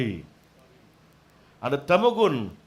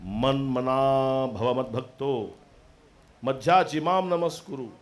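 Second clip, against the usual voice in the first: verse recitation; 6 semitones lower than usual. Acted.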